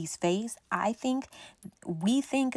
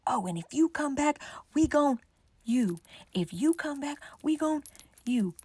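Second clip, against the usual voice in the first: higher mock threatening shout; mock threat; 6 semitones higher than usual. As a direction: imitate Bertha's threats, pitched up. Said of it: "Big Bertha start screaming".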